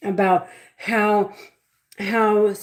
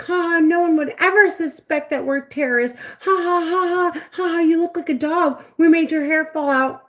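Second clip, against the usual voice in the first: higher, sneering mock laughter all through; mocking taunt; 9 semitones higher than usual. Voices in her head gloating over her, high mocking taunt. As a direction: gloat at her like a playground bully. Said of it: "'Cause they're like".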